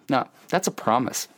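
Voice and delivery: usual speaking voice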